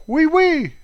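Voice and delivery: squealed high and loud fake French; mock French oui oui. Vlogger joking about a French-horn buddy in mock French. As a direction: pop it up high like a cartoon Frenchman.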